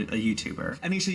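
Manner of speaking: usual speaking voice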